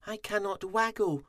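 high, sorry little voice; high-pitched, woeful complaint; moaning little bee. A narrator's reading. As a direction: moan it as a dejected tiny bee. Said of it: "poor Fuzzy moaned".

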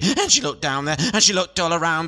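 usual speaking voice

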